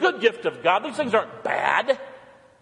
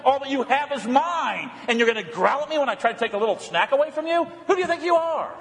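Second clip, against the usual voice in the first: hammed-up indignant scolding of a dog, higher pitched; mock scolding; 6 semitones higher than usual. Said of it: "I want to say what to my beast?".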